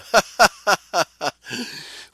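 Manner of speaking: long, high burst of laughter; real laugh at an absurd picture; amused laugh. Real, not acted. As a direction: crack up out loud. Amused by the odd idol picture.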